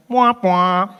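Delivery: mock sad-trombone noise; imitated failure horn. Doctor answering that he lost, comic failure sound.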